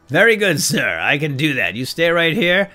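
loud, high, overeager helper; eager servant voice. Pretend. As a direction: play the eager deckhand. Role-playing with the pirate.

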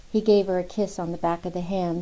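ordinary delivery, nothing marked

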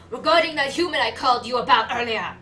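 loud game character voice; found character voice. Acted. Reporting to Undyne about the human, loud character voice. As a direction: deliver it loudly as the reporting underling. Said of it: "There we go, I think I found it".

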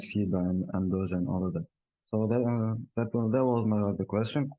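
ordinary talk

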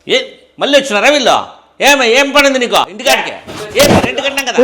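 loud, high, scornful shouting with a laugh; angry shout. Acted. Tenant shouts at the landlords who came back, loud and scornful. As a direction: yell them off the doorstep, mocking.

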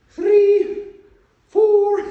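very high, loud falsetto English counting; mock English accent. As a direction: count in a hammed-up English lady voice. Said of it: "my English accent".

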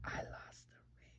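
ashamed whisper, barely voiced. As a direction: whisper the confession. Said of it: "Still can't hear you".

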